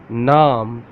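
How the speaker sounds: Naam with a long open "aa"; Urdu word. Shown as a demonstration. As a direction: stretch the aa in Naam. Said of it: "How to say in Urdu?".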